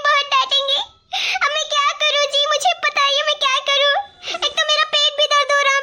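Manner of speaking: half-laughing fake sob through the words; hammed-up crying to win sympathy; mock sobbing. Bride fakes tears over lateness.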